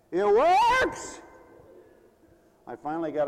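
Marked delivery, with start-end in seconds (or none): 0.1-1.2 high, loud, drawn-out shout; stretched-out preacher's cry; emphatic exclamation. Real, not acted. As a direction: holler it out triumphantly. Preacher exclaims his testimony.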